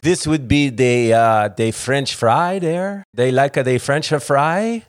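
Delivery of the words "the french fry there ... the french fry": mock French accent. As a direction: drag out a joking French accent. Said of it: "Is that Italian or French accent?".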